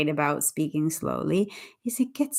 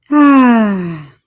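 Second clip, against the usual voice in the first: big, high falling sigh; loud sigh; 4 semitones higher, 13 dB louder than usual. Real, not acted. Host waiting for someone to join the live, loud sigh.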